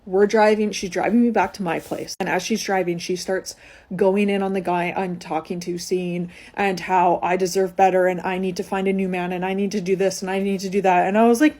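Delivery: ordinary talk, nothing marked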